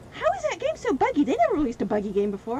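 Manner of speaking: high, loud incredulous whine; mocking disbelief. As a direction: play the baffled clueless gamer. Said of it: "and everybody's like".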